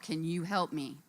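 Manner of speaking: ordinary talk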